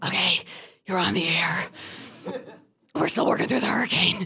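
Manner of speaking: worn-out hoarse radio host. Retold or imitated. Host after hours on air in hurricane, hoarse. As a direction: rasp it out as if the voice is nearly gone. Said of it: "Wendy's like, hoarse".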